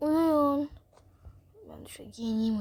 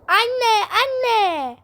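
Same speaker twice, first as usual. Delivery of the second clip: higher, louder crying call for mum; 9 semitones higher, 9 dB louder than usual. Acted. Child wailing for her mother to come, crying shout.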